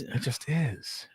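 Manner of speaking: hushed DJ whisper voice. Real, not acted. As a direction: drop to a soft whisper. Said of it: "And I whisper that".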